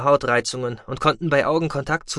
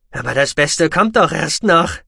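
loud threat in a raised pitch; menacing taunt; 4 semitones higher, 6 dB louder than usual. A narrator's reading. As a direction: promise the enemy worse to come.

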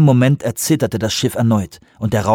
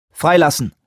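curt order, raised in pitch; harsh command; 5 semitones higher than usual. A narrator's reading. Lee orders a release in the lab, harsh command. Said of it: "Lees harscher Befehl".

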